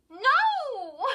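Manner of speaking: loud, high shriek of denial. A narrator's reading. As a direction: lose control in one long cry. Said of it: "Chris shrieked".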